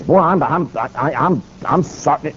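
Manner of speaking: imitated stuttering, dwindling apology; stammering. Flustered governor apologizing before onlookers, stammering. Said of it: "the governor is stammering and stuttering".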